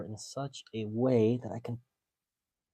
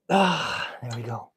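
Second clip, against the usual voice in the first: relieved exclamation; 9 dB louder, slower than usual. Real, not acted. Clip finally pops off.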